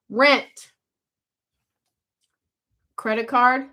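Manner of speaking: ordinary delivery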